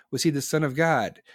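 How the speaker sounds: ordinary talk, nothing marked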